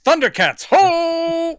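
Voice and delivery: high, loud heroic cry; Thundercats battle cry. Pretend. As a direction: belt it like the cartoon intro. Thundercats catchphrase shout.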